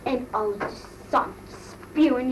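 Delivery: ordinary talk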